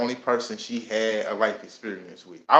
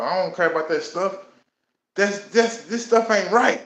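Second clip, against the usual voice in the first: retold anger; 6 semitones higher than usual. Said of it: "just going off, getting mad".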